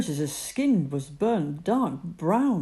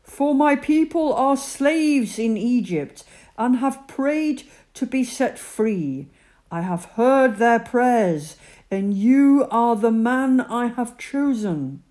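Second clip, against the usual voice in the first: God's voice; 6 semitones higher, 8 dB louder than usual. Acted. God explains to Moses.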